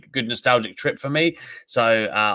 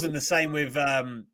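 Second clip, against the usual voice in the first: ordinary talk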